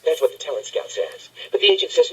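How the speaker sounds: usual speaking voice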